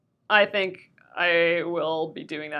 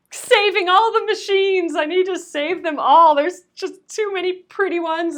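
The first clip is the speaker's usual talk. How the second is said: excited; 9 semitones higher, 5 dB louder than usual. Real, not acted. Gushes about saving old machines.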